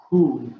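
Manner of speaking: whoo as a short falling oo; whoo sound. Shown as a demonstration. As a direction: breathe out whoo. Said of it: "as we say".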